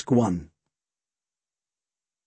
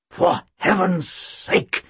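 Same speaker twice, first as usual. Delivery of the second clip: slow, rasped exasperation; doctor rasping at Tim in exasperation; 3 semitones higher, much slower than usual. Acted. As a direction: rasp it out, fed up. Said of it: "he rasped".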